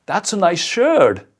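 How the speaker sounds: high, loud, slow mocking compliment; sarcastic compliment. Shown as a demonstration. Showing how tone changes a compliment, sarcastic. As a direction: drag it out with heavy sarcasm. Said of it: "the same words, and yet they sound so different".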